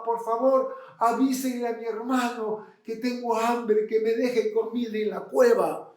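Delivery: high-pitched pleading call; leproso gritando. Acted. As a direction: beg from afar as the hungry leper. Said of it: "Y el leproso gritaba". (súplica aguda, llamando de lejos)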